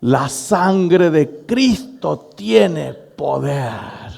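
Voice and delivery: long drawn-out, wailing proclamation; slow, over-the-top desperate invocation; desperate mock cry. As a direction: stretch every word out like a frantic battle cry. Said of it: "en ese tono / el grito mío, desesperante".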